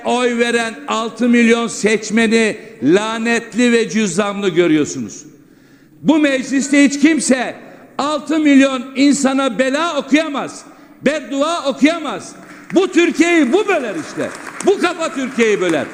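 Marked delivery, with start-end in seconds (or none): 6.0-15.9 impassioned, rising indignation; fiery rebuke pitched high; angry. Real, not acted. Heated speech from the rostrum.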